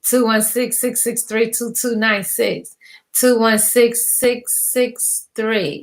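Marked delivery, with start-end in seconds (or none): 3.2-5.8 very slow, digit by digit; slow dictation. Real, not acted. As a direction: spell the number out slowly for note-takers. Repeats phone number for listeners.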